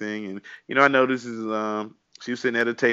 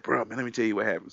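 ordinary delivery

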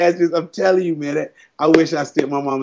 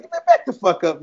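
defiant retort; 8 semitones higher, 4 dB quieter than usual. Retold or imitated. Acted retort to the roaring actor.

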